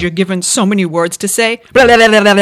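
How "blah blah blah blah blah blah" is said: mock babble. Mocking the limited-words superstition.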